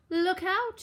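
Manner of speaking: high, urgent piglet cry; cried warning. A narrator's reading. Horace warns his brother, high frightened cry. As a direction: squeal a warning in panic. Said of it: "he cried".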